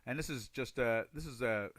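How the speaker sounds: usual speaking voice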